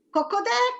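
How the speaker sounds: high cocodè like a clucking hen; light hen imitation. Shown as a demonstration. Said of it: "What's the sound of a hen".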